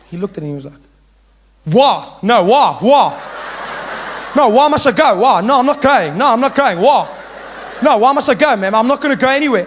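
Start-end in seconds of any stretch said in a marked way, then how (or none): 1.6-9.6 loud, indignant protest; defiant pupil. Acted. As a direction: refuse loudly, pitch jumping up. Jonathan defies the teacher.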